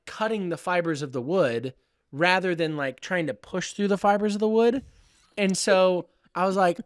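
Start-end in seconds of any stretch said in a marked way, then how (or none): none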